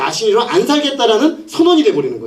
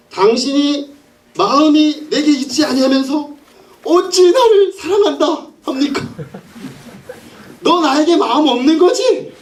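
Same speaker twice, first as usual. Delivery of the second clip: high, slow mock-weepy reproach; tearful pleading; 6 semitones higher, slower than usual. Woman crying to Samson for his secret, high acted tearful reproach. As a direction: draw it out in a hammed-up wail. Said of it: "울면서 말이 치명적이에요".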